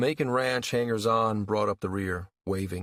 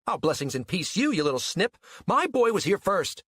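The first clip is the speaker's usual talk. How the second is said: high, mocking scold rising louder; angry retort; 10 semitones higher, 3 dB louder than usual. A narrator's reading. Rival mother shaking a finger at the newcomer, angry retort. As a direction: throw the blessing back angrily. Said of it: "aimed an angry, shaking finger at her".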